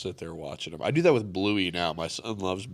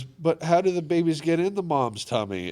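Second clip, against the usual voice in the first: put-on higher-pitched little kid question; curious child; 8 semitones higher than usual. Bluey asking her dad about babies, higher child voice. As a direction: voice a small girl asking dad. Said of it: "And she goes".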